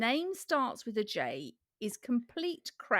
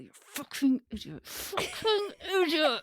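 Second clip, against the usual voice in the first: through gritted teeth; 4 semitones higher than usual. Shown as a demonstration. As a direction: hiss it through clenched teeth. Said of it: "Can you tell I'm gritting my teeth".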